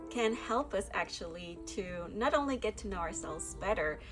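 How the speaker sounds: normal talking voice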